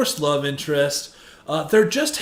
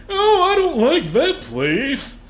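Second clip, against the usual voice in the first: higher, whiny mock complaint; whiny picky friend; 8 semitones higher, slower than usual. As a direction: play the fussy friend who ruins dinner plans. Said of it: "he's the one guy like".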